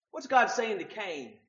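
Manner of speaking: ordinary delivery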